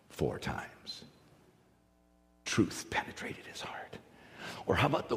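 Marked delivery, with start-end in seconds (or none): 0.1-4.0 breathy whisper. Real, not acted. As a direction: whisper the truth home. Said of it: "whispered point".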